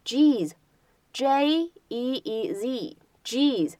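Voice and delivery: "jeez" slow with each letter spelled out; slow clear "jeez" for learners; spelled slowly. Shown as a demonstration. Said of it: "叫做jeez".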